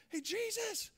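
high, loud mock yell for help; acted high-pitched shout. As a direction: holler for help, building in volume. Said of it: "he yells out to Jesus".

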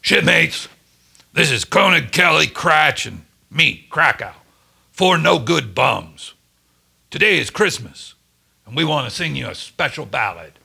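a mild booming announcement; booming bass. A narrator's reading. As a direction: call out to the whole ship, proud but lightly done. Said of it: "in his deep bass voice, he boomed".

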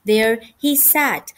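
plain reading voice

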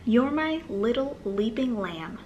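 ordinary delivery

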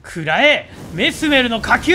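shouted attack call; screaming attack name. Pretend. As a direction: yell the move name in battle.

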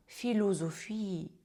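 «філозофії» said slowly, syllable by syllable; slow demo word. Shown as a demonstration. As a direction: spell out «філозофії» slowly for the class. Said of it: "Наприклад".

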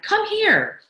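high, loud excited shout; calling out. A narrator's reading. Husband summons his wife downstairs, excited shout. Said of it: "he cried".

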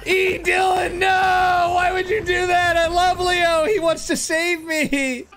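high, pleading shouts of outrage; shouted protest. Real, not acted. Streamer begging donors not to switch loadout, shouted protest.

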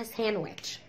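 "ساندويتش" as SAN-wetch, no d; silent d. Shown as a demonstration. Teacher restoring the proper word, "ساندويتش" with silent d. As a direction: return to "ساندويتش" without the d. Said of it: "بس هي طبعًا اسمها".

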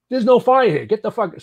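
loud, high yell to clear the crew out; retold shout. Firefighter yelling at his crew to get out, loud shout. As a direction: bark it like clearing a room.

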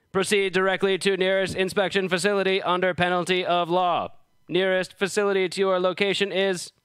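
loud, high announcing robot; robotic voice. Acted. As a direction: bark it out like a ship's computer. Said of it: "a robotic voice shouts".

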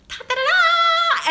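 high, loud, drawn-out fanfare; triumphant reveal. Real, not acted. Vlogger revealing freezer cukes to viewers, triumphant. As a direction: unveil it with a long, high flourish.

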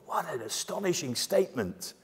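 ordinary talk, nothing marked

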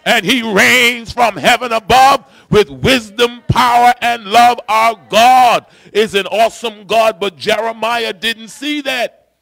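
rally the congregation to rejoice; preaching at full force. Acted. Preacher builds to celebration.

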